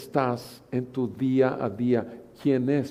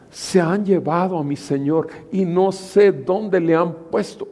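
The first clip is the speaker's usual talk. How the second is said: louder, pleading grief; grieving Mary; 4 semitones higher, 6 dB louder than usual. Acted. Mary at the tomb, higher pleading grief. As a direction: cry out the loss.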